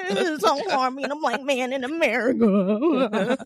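slow, laughing fake sob; hammed-up teary whine; mock crying. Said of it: "a little piece of him crying".

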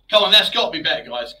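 loud and exasperated. Real, not acted. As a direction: push it out, fed up. Frustrated at mic fixing.

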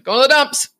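put-on a happy kid's reply; cheerful. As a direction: chirp it like a proud answer. Family joke, sing-song answer.

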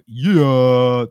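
loud, long rallying yell; big drawn-out shout. Real, not acted. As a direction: holler it out and hold it. Shouted sign-off to his answer.

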